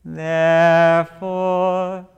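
normal talking voice

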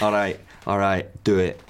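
ordinary delivery

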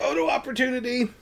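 very high, excited squeal; mock-eager shutterbug chirp. Shutterbug spots an ox cart, high excited squeal. Mimics Bess spotting a photo chance.

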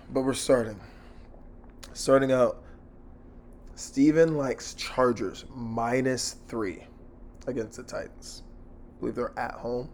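ordinary talk, nothing marked

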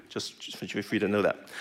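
soft unvoiced aside to the crowd; whispered aside. Real, not acted. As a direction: lean in and breathe it like a secret.

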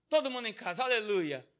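ordinary talk

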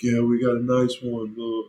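high-pitched mocking imitation of a hyped-up robber; high mock celebration; mimicked gloating robber. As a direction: put on a high, taunting voice. Mimics robber jumping on truck.

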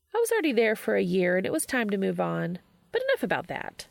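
put-on sing-song breezy young woman brushing off worry; upbeat Charlie. Narrator voices Charlie.

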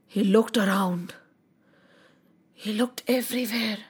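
low, hushed narration with long pauses; hushed shock. A narrator's reading. Storyteller shows the king stunned at destruction, hushed.

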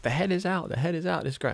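retold midwives announcing the head is out, high and excited. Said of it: "And they're like".

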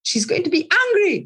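high, emphatic retold answer; mildly acted insistence; insistent client.

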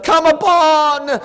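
high, loud fervent pleading; full-force preaching prayer. Real, not acted. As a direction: pour it all out to God at the top of your voice.

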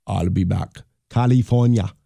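put-on a trashy Schwarzenegger with an Austrian accent; Schwarzenegger impression. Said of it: "stop doing the impression of, uh, Schwarzenegger".

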